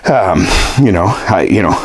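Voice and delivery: ordinary talk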